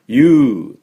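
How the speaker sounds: ユー drawn out loud as English "yoo"; stressed, long "yoo" for ユー; long "yoo" vowel. Shown as a demonstration. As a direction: hold ユー as the letter U. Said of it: "その二つ前の母音が".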